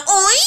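a high, loud "Oi!"; mock moan. Mocking the porn actress's moan, high and loud. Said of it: "Just bounce up and down".

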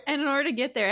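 normal talking voice

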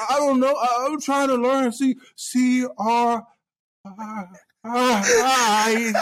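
put-on high, slow, unsure kid voice spelling; mock small boy guessing letters; imitated little kid. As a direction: spell it out like a lost child. Kid pleading.

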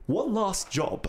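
hammed-up English accent, badly faked. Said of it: "He was English, so it'd probably be".

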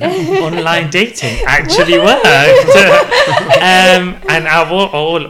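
laughing as it comes out; bursting into laughter, then a high giggle. Real, not acted. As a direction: giggle through the confession. Laughing reveal of Tinder.

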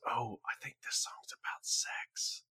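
whispering aside; hushed, secret realization; whispered realization. Retold or imitated.

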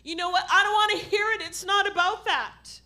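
forceful preaching. Real, not acted. Preacher urging an apologetic church to wake up, forcefully. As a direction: challenge the congregation with punched, ringing words.

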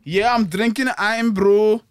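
slow put-on Scottish; mock Scottish, unhurried; Scottish accent. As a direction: spell out the words in a careful Scottish accent.

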